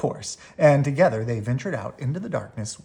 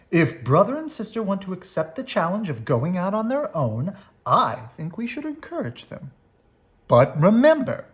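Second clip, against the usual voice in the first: Mama voice; 6 semitones higher, 4 dB louder than usual. A narrator's reading.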